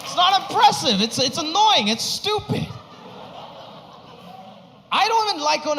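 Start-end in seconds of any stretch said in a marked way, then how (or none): 0.0-2.7 exasperated rant. Real, not acted. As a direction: let the annoyance burst out. Ranting about the greeting.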